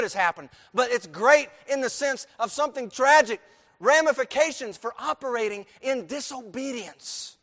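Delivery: loud, ringing preacher's cry; booming pulpit proclamation; preaching at full force. Acted. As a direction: hammer the hymn line home to the church.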